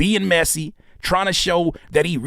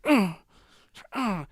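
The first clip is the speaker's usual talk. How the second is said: short grunts, higher than his voice; imitated tennis grunt; 6 semitones higher, 4 dB quieter than usual. Imitating her hitting the ball, short grunts. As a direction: mimic a player's grunt on each hit. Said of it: "She's like".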